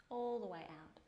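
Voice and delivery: usual speaking voice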